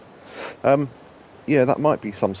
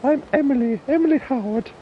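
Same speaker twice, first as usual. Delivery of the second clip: comic drag lady. Retold or imitated. Quoting a comedy sketch in a high drag lady voice. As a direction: squawk it like a man in a dress. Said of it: "Little Britain character quote".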